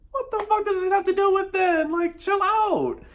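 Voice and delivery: a squealing plea to chill out; exasperated rant. Real, not acted. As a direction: pitch it way up in exasperation. Ranting about boyfriend's money fit.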